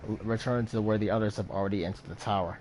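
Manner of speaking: ordinary talk, nothing marked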